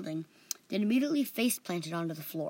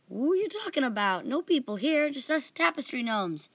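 flustered; 6 semitones higher, 4 dB louder than usual. A narrator's reading.

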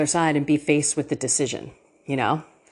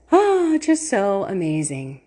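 usual speaking voice

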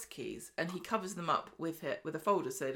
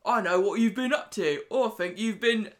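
put-on menacing southern accent, pushed louder and slowing down; 3 semitones higher, 8 dB louder than usual. A pub blackmailer threatens Stephen, in a loud southern accent. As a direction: lean into a southern accent as you close in. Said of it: "I wanted to do Rufus's accent".

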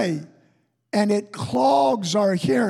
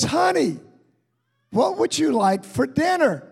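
calling out; 5 semitones higher than usual. Retold or imitated. Wife calling husband from kitchen.

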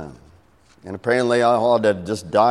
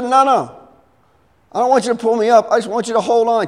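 high, raised-voice insistence; frantic refusal; 11 semitones higher, 5 dB louder, faster than usual. Acted. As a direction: shout it back urgently. Dangling woman shouts her refusal.

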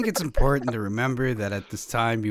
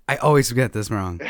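normal talking voice

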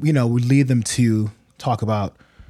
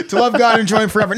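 laughing recital; 10 semitones higher, 5 dB louder, much faster than usual. Real, not acted. Jokingly reciting the catechism answer.

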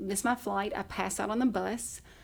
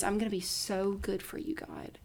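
soft whispered vow to God; whispered prayer; 7 dB quieter than usual. Retold or imitated. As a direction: whisper it like a private promise.

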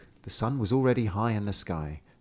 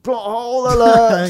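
loud high alarm shout; mock alarm; 12 semitones higher, 11 dB louder than usual. Mocking the story to her listener, loud mock alarm. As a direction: call it out like a siren.

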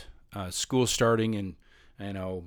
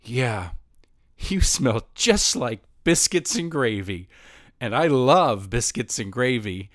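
put-on slow, earnest goofy boy voice; awkward student blurting an odd compliment; awkward kid voice; 3 dB louder, slower than usual. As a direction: say it with clueless sincerity, slowly. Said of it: "Charlie follows it up by saying".

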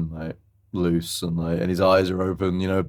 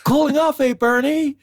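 calling out; 5 dB louder than usual. Retold or imitated. Boaters spot Bernie being dragged, high cheery calling out. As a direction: imitate a distant greeting called across water. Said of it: "you just hear people calling out".

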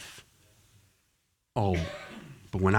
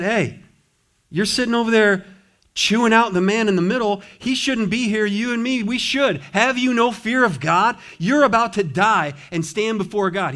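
much higher and louder, rebuking hard; preaching thief; 10 semitones higher, 8 dB louder than usual. Retold or imitated. Thief rebuking the other thief on the cross, loud preaching. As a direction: preach it like the thief chewing him out. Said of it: "He's preaching".

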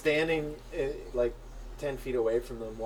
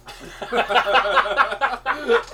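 cackling at own joke; loud laugh; 12 semitones higher, 10 dB louder than usual. Real, not acted. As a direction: burst of high laughter.

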